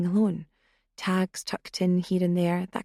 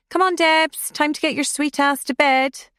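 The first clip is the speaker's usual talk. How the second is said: loud; 8 semitones higher, 5 dB louder than usual. A narrator's reading. Rousing a drunk friend.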